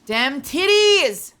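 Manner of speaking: slow, stretched mock exclamation; playful slow exclamation; drawn-out exclaim. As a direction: drag every word out for comic effect.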